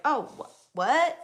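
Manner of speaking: high, indignant "what?" in a put-on voice; mock surprise. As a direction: play the caught-out one acting shocked. Mocking a caller-out's reaction.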